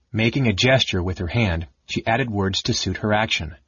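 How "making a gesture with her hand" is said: ordinary delivery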